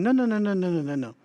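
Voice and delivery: imitated emphatic no. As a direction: imitate an insistent, not rude, repeated refusal. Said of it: "if they're going".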